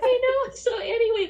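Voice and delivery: high, loud laugh-talking; real laughter spilling into the words. Real, not acted. Brushing off the host's praise, laughing high.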